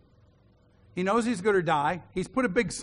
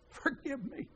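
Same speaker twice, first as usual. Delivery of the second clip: tender plea; 6 semitones higher, 10 dB quieter, slower than usual. Retold or imitated. Estranged son pleads at door.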